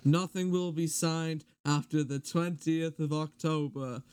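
slow, deliberate peace-and-love voice. Retold or imitated. Reciting his favourite fan-mail video, slow [name] voice. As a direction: warn them slowly with peace and love. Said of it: "I've memorized this".

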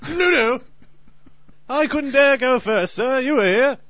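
high, loud, fussy politeness; overly polite fly. Pretend. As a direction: insist you couldn't possibly go first. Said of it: "two flies that are very courteous".

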